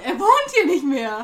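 WOHNT pushed out as the peak; stress on WOHNT. Shown as a demonstration. Joining the word-by-word game, stress on WOHNT. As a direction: hit the second word, WOHNT. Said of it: "jedes Wort einzeln betonen".

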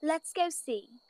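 slow, soft, comforting mother; sunny mother's voice. Acted. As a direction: soothe a frightened child. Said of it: "Bella's mother's voice was like sunshine".

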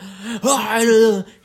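loud, high garbled yell; spluttering hammed-up scream; mock crazed scream. Man tackled by bar people, mock frothing scream. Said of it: "like, screams and troughs".

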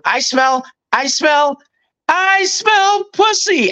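high squeal, climbing with each repeat; sing-out squeal, last time highest and drawn out; put-on catchphrase voice. Timing it as the door opens, high drawn-out squeal. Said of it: "I would go".

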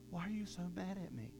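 soft, innocent-sounding question; mock calm. Someone calmly answering your anger, softly. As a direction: play it coolly unbothered.

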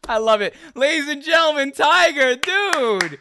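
loud, high-pitched laughing announcement; real hyped-up shout through laughter; thrilled cheering. Real, not acted. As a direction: whoop it up for the guest. Host cheers guest's final answer.

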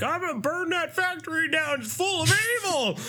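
high, cracked ranting voice; crazy homeless man. Retold or imitated. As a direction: rave like an unhinged drunk. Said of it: "a crazy homeless man who's like".

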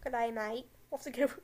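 hammed-up Australian accent. Joking after sliding into an Australian accent, hammed-up Australian. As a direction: play a jokey Australian bloke. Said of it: "Am I an Australian now?".

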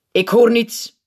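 loud, high, curt demand; short sharp reproach. A narrator's reading. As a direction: cut in like a stern teacher. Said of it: "zegt de juf kortaf".

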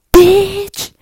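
high, full-volume yelled insult; loud shout. Retold or imitated. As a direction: belt it out as a sudden high yell. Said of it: "I probably shouldn't say that loud".

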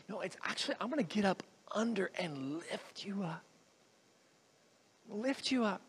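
whispery, very soft; hushed offer. Retold or imitated. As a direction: offer humble help under your breath. Humble offer to lift up.

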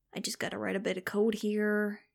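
plain reading voice

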